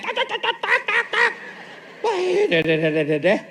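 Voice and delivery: high rapid tak tak chatter of a nagging wife; mimicked nagging. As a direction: rattle it off like endless nagging. (tiruan omelan istri yang cerewet, nada tinggi)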